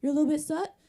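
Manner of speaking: loud, taunting imitation; mock a man dismissing a complaint; sarcastic needling, rising into questions; mocking man's logic. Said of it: "logic a man would say".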